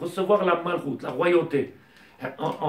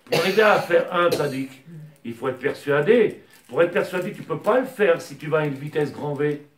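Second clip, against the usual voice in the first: raised, louder sermon voice driving each question home; fervent preaching; 4 semitones higher, 6 dB louder than usual. Acted. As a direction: preach it to the congregation with fire. Rabbi preaching at full force.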